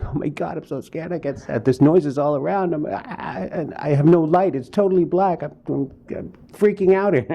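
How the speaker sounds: hammed-up high-pitched panic, stammering; scared, freaking out. Actor retelling frightened emails to his wife, mock panic. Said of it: "I can't tell you how scared".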